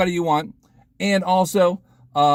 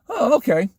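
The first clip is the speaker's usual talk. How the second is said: high, quicker reply; mock-surprised acceptance; 10 semitones higher than usual. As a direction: pop it up high in mild surprise. Retold same reply at the door.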